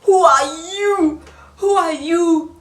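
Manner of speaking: loud high indignant cry; who are you, shouted in disbelief; mock outrage. Mock outraged intro question.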